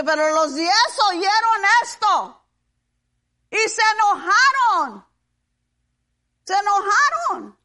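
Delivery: high, loud, emphatic sermon voice; preaching. Real, not acted. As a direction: punch the verb out to the congregation. Preaches on the passage.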